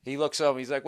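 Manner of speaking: ordinary talk, nothing marked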